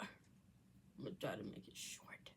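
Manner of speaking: whispered aside, no voice; breathy repeat under the breath. Shown as a demonstration. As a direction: whisper it to yourself. Whispered repeat of own line.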